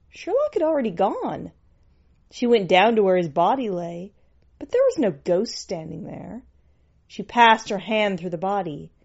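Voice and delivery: ordinary delivery, nothing marked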